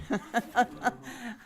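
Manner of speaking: light laughing fit; amused laugh. Real, not acted. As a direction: laugh at your own quip. Audience reacts to her opening joke.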